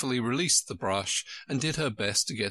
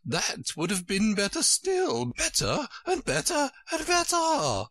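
each BETTER higher than the last; rising to a squeak; 14 semitones higher than usual. A narrator's reading. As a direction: climb from high queen voice to a squeak. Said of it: "voice went higher with each".

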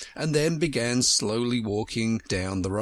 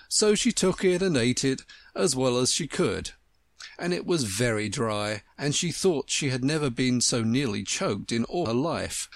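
ordinary delivery

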